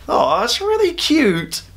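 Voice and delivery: high, slow gushing coo. Retold or imitated. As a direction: melt over something adorable. Gushing reaction to sweet moment.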